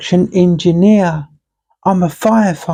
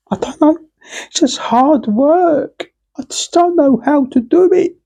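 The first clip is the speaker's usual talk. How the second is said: high whining, lost and stuck; whiny helpless voice; 9 semitones higher than usual. Acted. Stuck on what to give up, whining high. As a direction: whimper it out like a helpless kid.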